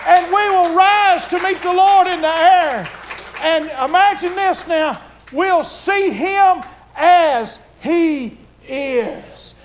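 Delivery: loud, high fervent preaching; preaching at full force. Acted. Preacher describes the rapture to the church, fervent loud preaching. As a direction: build the crowd toward the climax.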